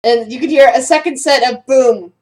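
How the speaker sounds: normal talking voice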